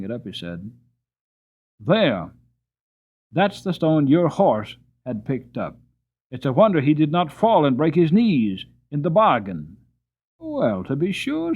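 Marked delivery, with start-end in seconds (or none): 1.8-9.8 farmer reproving. A narrator's reading. Farmer shows the stone. 10.4-11.5 surprised driver. A narrator's reading. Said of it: "said my driver".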